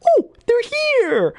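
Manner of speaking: panicked squeaky henchman; Bobcat Goldthwait. Retold or imitated. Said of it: "Bobcat Goldthwait".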